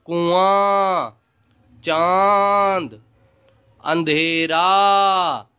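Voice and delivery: कुआं, चांद, अंधेरा drawn out slowly, nasal vowels held; three words stretched out for children; slow nasal demo. Shown as a demonstration. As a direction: say each example slowly through the nose. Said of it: "उदाहरण के द्वारा समझाया गया है".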